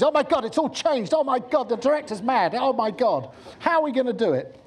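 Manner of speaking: high, loud, rushed panicky crew voices; mock blind panic. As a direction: squawk it like a crew running in all directions. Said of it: "It's called blind panic".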